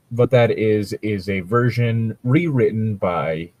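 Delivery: ordinary talk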